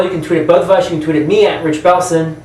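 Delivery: normal talking voice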